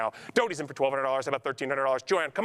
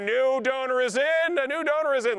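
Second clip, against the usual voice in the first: high, louder, slowed hype for the newcomer; hyped announcement; 7 semitones higher, 4 dB louder than usual. Real, not acted. Auctioneer hyping a new donor to the crowd, high and loud. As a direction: sell the moment, loud and high like a ringside call.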